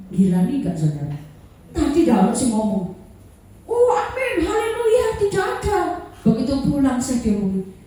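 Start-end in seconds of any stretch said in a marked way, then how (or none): 3.7-5.8 high sing-song fake holy voice, amen and hallelujah; mock pious denial. Preacher mimics churchgoers saying amen while denying it, sing-song. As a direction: chant it sweetly like a hypocrite hiding something.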